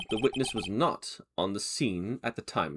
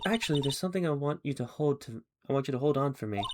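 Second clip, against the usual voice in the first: ordinary delivery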